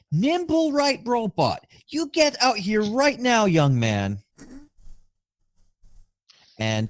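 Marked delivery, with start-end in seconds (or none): 0.1-4.2 high, loud scolding call across the room; stern mother calling out. Acted. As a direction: call him out like an angry mom. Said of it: "she just calls out".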